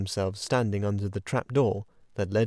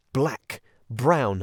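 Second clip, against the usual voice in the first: usual speaking voice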